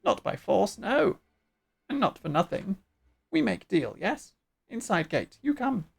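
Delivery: swooping, squawky bird-creature voice. Acted. Bird chief making a deal, squawky. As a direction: bargain and scold as a bird chief. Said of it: "doing the bird voice".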